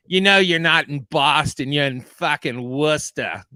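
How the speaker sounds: put-on loud cranky Boston accent; old Boston lady snapping. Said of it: "that Boston accent was perfect".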